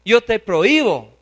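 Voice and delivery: loud, forceful forbidding; very angry. Acted. Peter orders his Lord not to do it, very angry. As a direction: lay down the law to a superior, loudly. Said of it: "se puso muy bravo diciendo".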